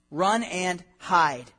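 very slow, each word drawn out; dragged-out run and hide; slow emphatic repeat. Shown as a demonstration. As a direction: stretch run and hide out, heavy.

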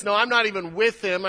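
loud, high protest; forceful denial. Acted. Peter escalating his denial of Christ, forceful.

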